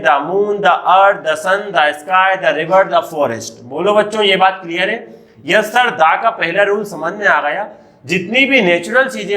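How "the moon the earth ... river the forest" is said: slow examples. Shown as a demonstration. Teacher to children, slowly listing natural things. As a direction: list the sun the sky slowly. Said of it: "दा का use करते हैं".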